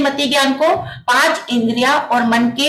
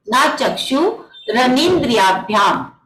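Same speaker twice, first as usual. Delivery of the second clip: ना चक्षुरनिंद्रियाभ्याम said slowly, each syllable drawn out; slow it right down so students can follow each syllable of ना चक्षुरनिंद्रियाभ्याम; slow recitation; slower than usual. Shown as a demonstration. Teacher repeating the sutra for the Zoom class, slow careful recitation. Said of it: "तो देखिए".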